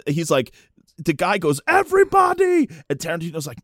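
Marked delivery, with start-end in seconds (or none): 1.7-2.7 high, loud imitated announcement; mock excited shout; calling out. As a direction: holler to the whole theater. Fan calling out to whole theater.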